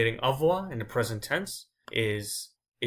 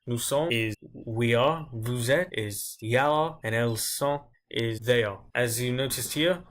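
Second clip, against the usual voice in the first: ordinary talk, nothing marked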